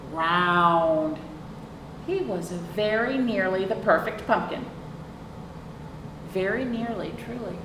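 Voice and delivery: plain reading voice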